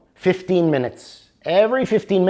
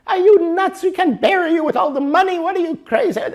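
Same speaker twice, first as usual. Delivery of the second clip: much higher, mock-outraged wife voice; shrill wife; 4 dB louder, faster than usual. Wife scolding her dying husband in a high mock-outraged voice. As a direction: play the wife, scandalized and squeaky.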